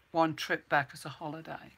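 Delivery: ordinary talk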